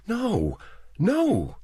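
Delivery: high, hurried, frightened no; quick, scared protest; quick denial. A narrator's reading. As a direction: blurt it out fast and high. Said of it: "she said quickly".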